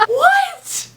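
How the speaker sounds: high, cracking squeak of disbelief; stunned disbelief. Real, not acted. Vlogger opening a surprise gift, squeaking in disbelief. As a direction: gasp out the question, overwhelmed.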